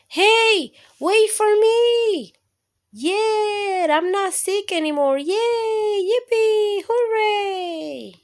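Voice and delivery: put-on very high, loud cheering kid; joyful shouting. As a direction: whoop with delight, running to school. Simon runs back to school.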